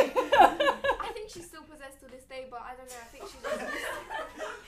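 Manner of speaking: words squeezed out through loud laughter; can barely get it out for laughing; giggling helplessly mid-sentence. Real, not acted. Laughing while telling the story.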